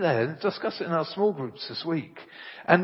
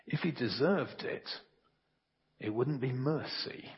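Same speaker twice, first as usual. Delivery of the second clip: quiet reply; 7 dB quieter than usual. Acted. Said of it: "his mum replied".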